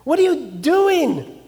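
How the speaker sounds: high, alarmed cry of frightened sailors. A narrator's reading. Sailors panicking at Jonah, a high alarmed cry. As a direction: gasp it out in rising panic. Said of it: "Then the men were exceedingly afraid".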